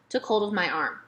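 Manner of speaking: plain reading voice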